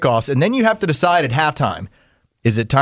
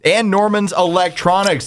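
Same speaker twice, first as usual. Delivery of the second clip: ad read; 4 semitones higher than usual. Acted. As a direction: read the sponsor like an announcer. Podcast intro read.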